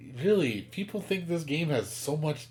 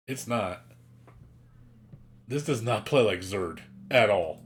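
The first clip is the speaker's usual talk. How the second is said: normal talking voice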